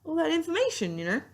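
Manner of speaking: words stretched by a yawn. Real, not acted. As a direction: talk through a yawn. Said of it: "Sorry, I'm yawning".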